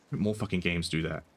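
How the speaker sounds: normal talking voice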